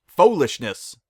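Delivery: loud, curt dismissal; dismissive. A narrator's reading. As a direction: cut her off loudly and firmly. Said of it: "in a tone that made it clear she wanted to hear no more".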